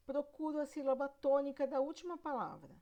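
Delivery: usual speaking voice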